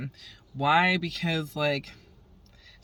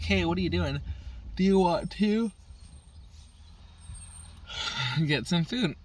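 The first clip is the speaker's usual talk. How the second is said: chirpy invite; 5 semitones higher than usual. Retold or imitated. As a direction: sweetly invite stepdad out, higher. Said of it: "was like".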